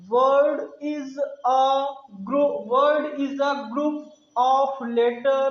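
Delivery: word-by-word definition, drawn out; very slow, emphatic teaching delivery; slow recitation. Shown as a demonstration. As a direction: recite slowly for learners to remember.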